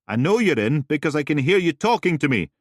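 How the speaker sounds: loud, high, sarcastic call; calling through a door. Acted. Roberta calling sarcastically through the door.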